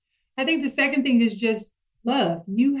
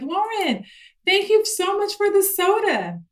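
put-on much higher, sweet child voice; little friend; 11 semitones higher than usual. Friend thanking Lauren for the soda, high child voice. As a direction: imitate the grateful little girl. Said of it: "Her little friend was like".

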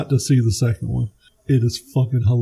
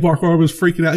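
ordinary talk

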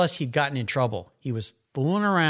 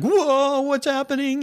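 high, loud startled yelp, imitating the son; mock panic as the turbo kicks in; 13 semitones higher, 5 dB louder, slower than usual. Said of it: "and he's like".

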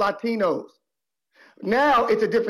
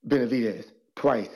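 ordinary talk, nothing marked

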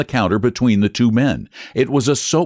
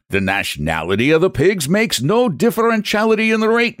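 higher, stubborn Irish clerk; Irish brogue; 6 semitones higher than usual. Acted. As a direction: lecture him in a thick brogue. Flannery insists in brogue.

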